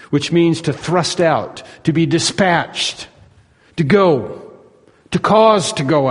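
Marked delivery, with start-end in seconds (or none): none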